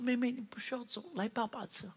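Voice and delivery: soft, quiet father's comfort; tender comforting. Retold or imitated. Father holds his bleeding daughter and soothes her, soft and tender. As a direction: hush her gently as you hold her.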